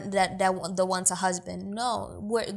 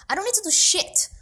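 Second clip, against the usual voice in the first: high-pitched, louder defiant comeback; defiant retort; 10 semitones higher, 5 dB louder than usual. Acted. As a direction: push back hard, pitch jumping up. Defiant retort to quoted sexist demands.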